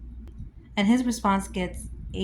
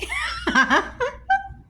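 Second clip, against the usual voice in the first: very high, delighted squeal of laughter; real helpless shriek; 4 dB louder than usual. Real, not acted. Laughs at "are you okay.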